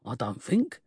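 sergeant's bluster petering out; weak ending. A narrator's reading. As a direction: end weakly, losing authority. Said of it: "and ended weakly".

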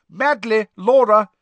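loud, high roll call; calling out names. A narrator's reading. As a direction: summon a pupil across the hall. Teacher calls out name.

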